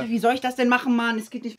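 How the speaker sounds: loud frustrated outburst; shouting match. Real, not acted. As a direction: yell it in frustration. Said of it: "Schrei mich nicht an".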